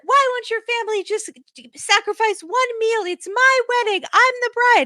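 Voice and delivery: very high indignant bride impression; high-pitched mock bridal tantrum, off the mic; Jasmine. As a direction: protest dramatically in a high put-on voice. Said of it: "when you did Jasmine".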